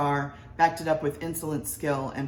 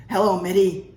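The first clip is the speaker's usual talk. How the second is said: doctor greeting; 3 semitones higher, 10 dB louder than usual. A narrator's reading.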